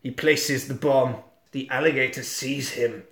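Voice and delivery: ordinary delivery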